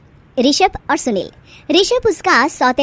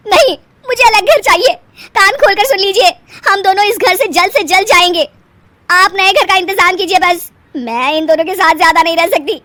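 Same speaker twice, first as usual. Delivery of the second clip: loud, higher, crying insistence; tearful demand; 8 semitones higher, 7 dB louder than usual. Acted. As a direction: insist through tears. Wife demands new house tearfully.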